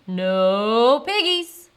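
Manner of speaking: loud, slow cow voice; lowing, drawn-out answer; mooing cow. Acted. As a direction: stretch the words into a moo.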